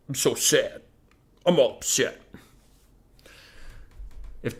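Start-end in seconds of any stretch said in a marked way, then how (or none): 0.1-2.2 lightly put-on pouty voice; mock sulking. Lords sitting with arms crossed, mock sad sulk. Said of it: "arms crossed and be all".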